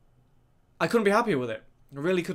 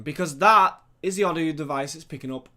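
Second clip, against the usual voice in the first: ordinary talk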